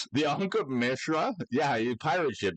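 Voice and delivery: tongue pinned, sounds smeared; holding your tongue. Shown as a demonstration. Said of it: "You gotta say it while holding your tongue".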